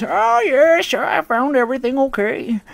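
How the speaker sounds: put-on high, sugary old lady voice; sweet old lady. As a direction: imitate a polite elderly customer. Customer's voice in retold story.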